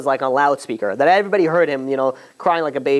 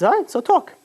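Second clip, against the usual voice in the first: much higher, impatient; curt reply; 12 semitones higher than usual. Retold or imitated. Moshe impatiently letting Pharaoh speak, curt and high. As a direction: brush him off: go on then. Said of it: "He says".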